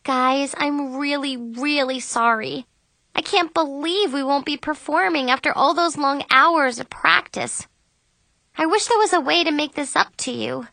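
high, plaintive apology; apologetic. A narrator's reading. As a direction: apologize as if heartbroken. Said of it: "so I just apologized again".

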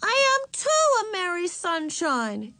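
put-on very high, stubborn child voice; insistent little girl. A narrator's reading. Little sister sent to her room, still insisting. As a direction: insist at the top of a small child's range. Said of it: "insisted Ramona".

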